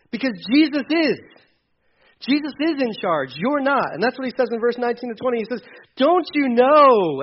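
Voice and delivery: impassioned sermon appeal, pitch lifted high; fervent preaching. Acted. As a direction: urge the crowd as if Paul himself were speaking. Preacher voicing Paul's appeal.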